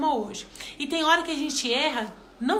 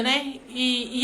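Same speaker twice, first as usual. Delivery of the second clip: usual speaking voice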